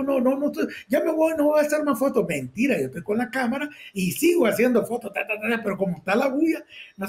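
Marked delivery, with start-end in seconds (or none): 0.0-2.2 high frightened pleading. Retold or imitated. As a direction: promise to leave, voice pitched up in fear. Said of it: "le digo". (súplica nerviosa en tono alto)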